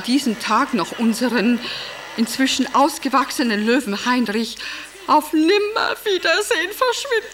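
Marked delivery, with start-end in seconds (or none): 5.1-7.3 high-pitched, crying grief; tearful lament. Acted. Wife recalling the crisis over her vanished lion, tearful. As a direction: wail it through tears, much higher than usual.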